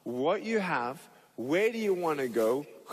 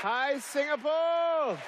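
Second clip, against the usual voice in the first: big slow stage greeting to the crowd; calling out; 10 semitones higher, slower than usual. Real, not acted. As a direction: call out to the whole arena.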